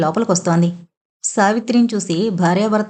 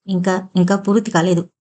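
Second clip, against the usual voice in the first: ordinary delivery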